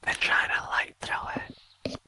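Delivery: slow whispered retort; whispering back. Acted. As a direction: whisper it back slowly at the fog. Player answers the whispers in whisper.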